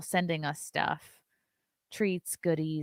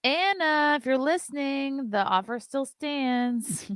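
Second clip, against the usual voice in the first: calling out; 8 semitones higher, 7 dB louder, slower than usual. Real, not acted. Calling out to Anna.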